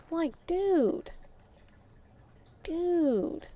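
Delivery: high, falling "dude" of disbelief; incredulous. Retold or imitated. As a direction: stretch it out, appalled and disbelieving. Stunned at what he said.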